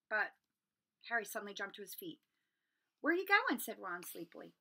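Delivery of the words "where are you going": high, loud sudden question; urgent call. Acted. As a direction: call out as he jumps up. Harry calls out urgently.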